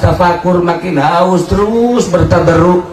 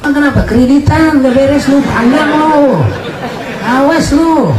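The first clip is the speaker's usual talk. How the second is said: mock scolding; 9 semitones higher than usual. Preacher mocks scolding a debtor.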